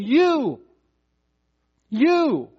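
each "you" high and drawn out, then falling; emphatic you. Retold or imitated. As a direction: preach "you" straight at the crowd, pausing between. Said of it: "It's there for emphasis".

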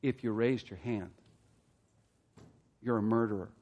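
quiet and slow. Shown as a demonstration. Preacher letting a hard truth sink in, quiet and slow. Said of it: "I want you to let that sink".

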